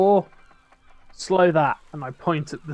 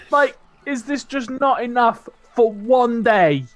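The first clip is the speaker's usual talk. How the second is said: exasperated wail; 7 semitones higher, 6 dB louder than usual. Acted. Exasperated yell at storm continues.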